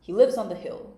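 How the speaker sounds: loud bluff king voice; a loud, blustering king. A narrator's reading.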